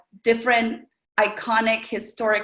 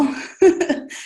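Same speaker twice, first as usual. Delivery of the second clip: a short high burst of laughter; bright laugh; 8 semitones higher, 3 dB louder than usual. Real, not acted. Laughing at how grateful she was for a toilet. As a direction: laugh at your own admission.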